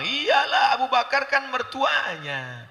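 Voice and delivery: high-pitched, drawn-out "of course"; mock dismissive gossip. Onlookers crediting family ties, high mock dismissal. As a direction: imitate people scoffing at favouritism. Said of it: "apa kata orang?".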